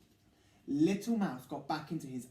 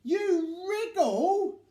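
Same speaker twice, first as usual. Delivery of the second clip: slow, high, loud grumble in another voice; grumpy complaint; 14 semitones higher, 9 dB louder, much slower than usual. Acted. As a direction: drag it out, annoyed. Big Mouse complains.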